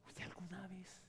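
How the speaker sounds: whispered question; soft whispered question. Acted. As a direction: lean in and whisper. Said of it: "whispered question".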